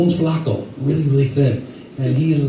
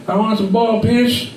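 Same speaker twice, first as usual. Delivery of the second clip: put-on lightly drawled Southern accent, higher; hint of a Georgia Southern voice; Southern customer ordering peanuts, lightly Southern; 6 semitones higher than usual. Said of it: "with the southern accent".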